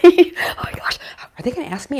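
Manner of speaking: breathless eager whisper; excited whisper. Retold or imitated. Parent secretly hoping the teen will ask, in a whisper. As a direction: whisper it, bursting with hope. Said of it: "that you're like".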